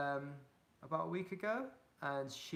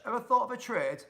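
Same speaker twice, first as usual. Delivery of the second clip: louder, higher Yorkshire accent; real Yorkshire accent; 9 semitones higher, 10 dB louder than usual. Real, not acted. Streamer reading out a viewer's comment, in a Yorkshire accent. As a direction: read it out loud in broad Yorkshire. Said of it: "said that in a real Yorkshire accent".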